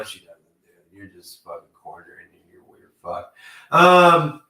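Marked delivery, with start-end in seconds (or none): 0.0-3.3 much softer, trailing into whisper; fading mutter. Real, not acted. Dropping to a near whisper about Mister McMahon. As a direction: mutter it under your breath.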